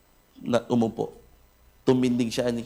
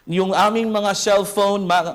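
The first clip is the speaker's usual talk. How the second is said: ordinary talk, nothing marked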